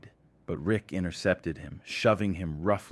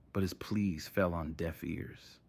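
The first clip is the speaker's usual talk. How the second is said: usual speaking voice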